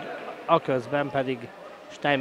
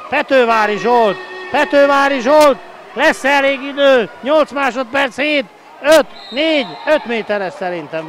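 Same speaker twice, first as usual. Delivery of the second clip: excited sports commentary; 8 semitones higher, 7 dB louder than usual. Real, not acted. Urging team in final seconds.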